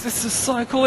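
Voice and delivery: high, loud, carried-away cry; thrilled. Acted. Australian loses his head at the top, high excited shout. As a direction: let the thrill run away with you. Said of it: "he just loses his head".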